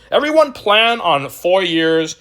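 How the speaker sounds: giddy send-up of hopeful holders; mocking dreamer. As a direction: ham up the yacht-and-Lambo dream. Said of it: "they didn't hear you on YouTube saying".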